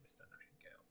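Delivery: breathy sinister whisper of the name; menacing whisper. Acted. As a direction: whisper it like a threat. Menacing farewell.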